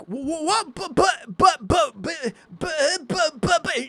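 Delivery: high-pitched, hammed-up helpless stammer; sputtering protest. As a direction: stutter in squeaky mock disbelief. Acts out his sputtering at refusal.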